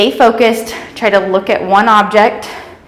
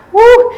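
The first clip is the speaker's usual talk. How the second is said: high, loud cheering whoop; excited whoop; 14 semitones higher, 5 dB louder than usual. Real, not acted. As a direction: whoop to pump up the class. Instructor cheering mid-exercise.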